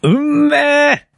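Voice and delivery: long drawn-out relish; savoring delight. Acted. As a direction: stretch it out, tasting every bit. First bite of eel. (ゆっくりと味わう感嘆)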